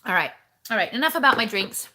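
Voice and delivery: ordinary talk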